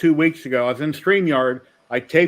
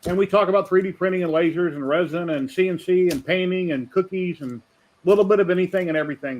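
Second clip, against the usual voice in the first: announcer; 3 semitones higher than usual. Acted. Stream intro for raiders.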